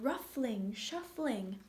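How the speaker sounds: plain reading voice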